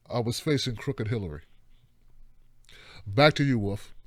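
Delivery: low, fast anchorman read; news reporter voice. Pretend. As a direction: deliver it like a TV anchor. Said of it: "in best news reporter voice".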